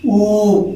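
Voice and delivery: ऊ said with both lips pushed close and rounded; teacher showing how the lips round for ऊ; rounded vowel demo. Shown as a demonstration. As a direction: hold a long rounded ऊ for the children. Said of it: "हम कहते हैं".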